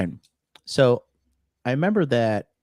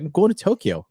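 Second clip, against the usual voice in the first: higher, indignant retort to the guard; giving attitude; 9 semitones higher, 5 dB louder than usual. Retold or imitated. To airport guards asking his destination, indignant retort. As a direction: snap back with attitude. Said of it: "'Cause I gave him an attitude".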